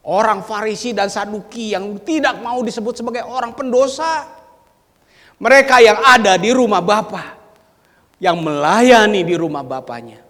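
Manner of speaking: loud, high-pitched preaching; forceful preaching. Acted. Preacher loudly naming the Pharisees and Sadducees. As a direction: drive the point home.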